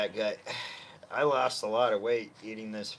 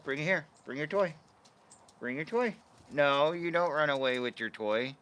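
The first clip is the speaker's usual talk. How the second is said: high pet-talk voice; high calling voice for the dog; 5 semitones higher than usual. Real, not acted. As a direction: coo at the puppy. Coaxing dog to bring toy.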